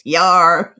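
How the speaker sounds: growled pirate yar; quick hammy pirate growl. Pretend. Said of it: "Pirate voice".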